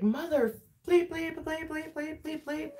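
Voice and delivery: high, thin mock cursing; mock road rage. Imitates her own road rage cursing. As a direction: squeaks out curses at other drivers. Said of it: "get road rage".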